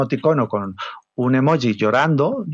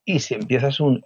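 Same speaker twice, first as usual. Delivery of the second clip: usual speaking voice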